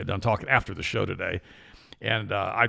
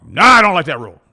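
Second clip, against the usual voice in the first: loud, higher, mock-defiant no; playfully acted loud refusal; indignant refusal; 7 semitones higher, 15 dB louder than usual. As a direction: bark back like a stubborn kid. Retold chat, refusing each rule.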